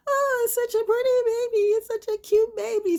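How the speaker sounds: very high mock-gushing coo over an ugly baby. Onlookers cooing over a baby, high mock gush. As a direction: coo like a fake-sweet onlooker.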